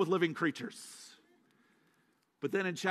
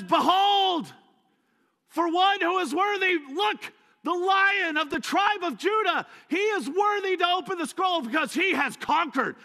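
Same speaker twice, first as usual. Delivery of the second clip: high, loud proclaiming shout; triumphant herald; 9 semitones higher, 4 dB louder than usual. Retold or imitated. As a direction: announce the victor to all heaven. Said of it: "He says".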